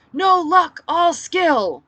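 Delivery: high, loud crowd roar. Acted. The fighters answer their leader, roaring. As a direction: roar it back like a fired-up crowd. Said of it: "they roared".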